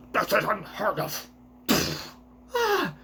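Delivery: a lightly put-on pompous noble; celestial dragon voice. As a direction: play up the outrage a little. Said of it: "I give the worst voices to all of the, uh, celestial dragons".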